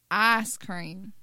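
Southern "ahs cream"; Alabama accent. Shown as a demonstration. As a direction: flatten "ice" to a long Alabama "ahs". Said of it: "like in Alabama".

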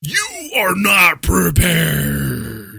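slow, booming villain proclamation; mock shout. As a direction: shout it drawn out and loud, like a game boss. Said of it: "You just shout that in there".

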